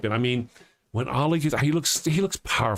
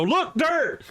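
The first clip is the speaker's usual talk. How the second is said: high, thrilled cry of discovery; excited squeal. Pretend. Canadians rushing to bare dirt, high excited cry. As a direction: squeal like you spotted treasure. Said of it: "run over and go".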